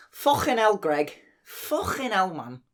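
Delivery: high, exasperated Scouse; scolding in a Scouse voice; Scouse accent. Shown as a demonstration. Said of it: "a list of accents here that I'd like you to do".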